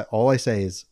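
ordinary talk

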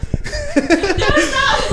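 short high burst of laughter. Real, not acted. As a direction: break into laughter.

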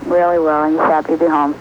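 phone line voice; over the phone. Real, not acted. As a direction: sound like a phone call. Call to Thompson residence.

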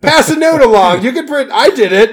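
loud, high and laughing; excited laughing plea. Real, not acted. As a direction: gleefully call out to her. Begging a listener to get in touch.

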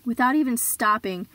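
ordinary talk, nothing marked

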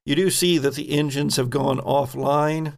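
ordinary talk, nothing marked

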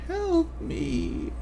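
high, thin, soft whimpering plea; a dying woman begging weakly for help. Acted. Game master voices the skinned woman pleading with the party, whimpering. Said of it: "you hear her whimper".

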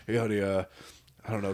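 low, soft, hesitant voice; hushed phone call. Acted. Passenger calling his wife during hijacking, subdued. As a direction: speak quietly and haltingly into the phone.

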